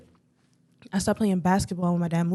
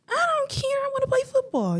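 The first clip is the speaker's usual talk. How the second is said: put-on very high-pitched kid-like whine; mock protest. Daughter insisting to dad about football, mock protest. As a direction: replay a childhood protest in a squeaky voice.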